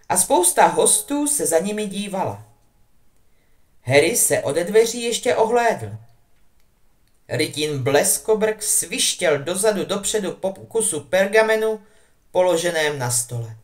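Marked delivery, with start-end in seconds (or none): none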